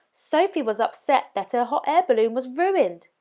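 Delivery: ordinary delivery, nothing marked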